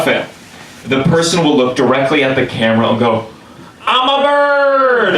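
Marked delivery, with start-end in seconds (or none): 3.8-5.2 high, long-drawn exultant cry; mock-triumphant shout, stretched right out; ecstatic whoop. Skydiver yelling to the camera mid-jump, mock-ecstatic.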